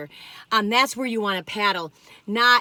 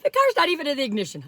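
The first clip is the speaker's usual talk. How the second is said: spooked woo; 7 semitones higher, 6 dB louder than usual. Real, not acted. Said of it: "I'm like".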